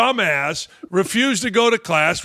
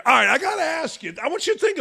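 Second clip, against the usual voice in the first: excited shout; 8 semitones higher, much faster than usual. Real, not acted. Revving up chat audience.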